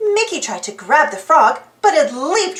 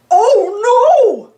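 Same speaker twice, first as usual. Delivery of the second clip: high, loud alarmed shout; 7 semitones higher, 6 dB louder than usual. A narrator's reading. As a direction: cry out in alarm. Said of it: "Goofy shouted".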